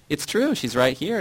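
mild, lightly acted shout. A narrator's reading. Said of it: "Yara shouted".

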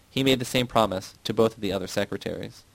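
usual speaking voice

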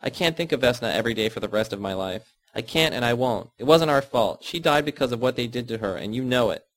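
ordinary delivery

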